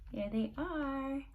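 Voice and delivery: usual speaking voice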